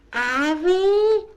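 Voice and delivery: "Have he?" soaring very high at the end; loud, sharply climbing "Have he?"; rising question. Shown as a demonstration. Lady hears of neighbour's remarriage, "Have he?" shooting right up. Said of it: "that's how your voice goes up at the end".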